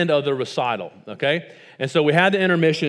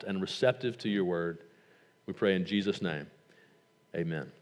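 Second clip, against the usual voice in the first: soft, reverent prayer voice; a hushed pastoral prayer; quiet prayer; 6 semitones lower, 7 dB quieter than usual. Acted. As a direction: pray it gently to the congregation. Leads the congregation in prayer.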